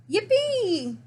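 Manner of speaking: high, loud goat bray; braying yippee. Acted. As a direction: bray it out with joy. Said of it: "brayed Goat".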